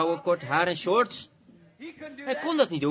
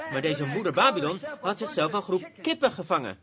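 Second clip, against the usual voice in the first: normal talking voice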